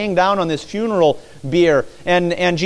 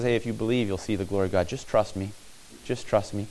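gentle reassurance; 5 semitones lower, 7 dB quieter, faster than usual. Retold or imitated. Jesus calming Martha.

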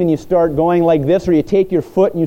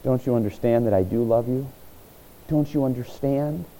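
soft, hushed plea of hurt love; Jesus pleading softly; 3 semitones lower, 8 dB quieter than usual. Retold or imitated. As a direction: speak it quietly, like wounded love. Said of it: "He's saying".

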